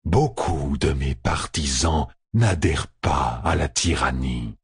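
low, slow voice from the shadows; dark presence. Acted. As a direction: speak as the darkness itself. L'obscurité répond. (voix grave et lente du ténébreux)

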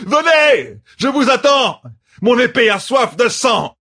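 high, shouted taunts of a battle-crazed lieutenant; frenzied bellowing. A narrator's reading. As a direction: roar your challenge at the monsters, pitched high. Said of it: "beugla le lieutenant". (cris de guerre exaltés, voix aiguë)

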